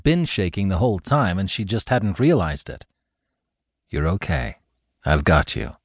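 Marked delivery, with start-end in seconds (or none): 3.9-5.8 lower, gentle reassurance; calm her like a rescuer; soothing. Acted. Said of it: "His voice soothed her".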